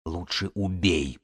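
low-energy, slow, soft rasp; hoarse croak. A narrator's reading. Pinned woman begs Белка for death, croaking hoarsely. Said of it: "прохрипела Эва".

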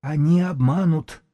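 high, slow warning; high wheezy warning; hoarse character's voice. A narrator's reading. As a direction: warn them slowly in a high, husky voice. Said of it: "голос его дребезжал и хрипел".

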